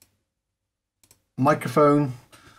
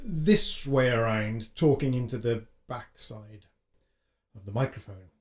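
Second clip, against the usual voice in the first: boxy, muffled and distant; muffled mic; 4 semitones lower, 7 dB quieter than usual. Shown as a demonstration. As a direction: talk into the back of the mic. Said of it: "my boxy and slightly muffled voice".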